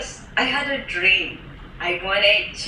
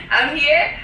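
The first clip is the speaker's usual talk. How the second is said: laughing; 6 semitones higher, 5 dB louder than usual. Real, not acted. Laughing at own situation.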